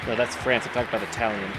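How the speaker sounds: usual speaking voice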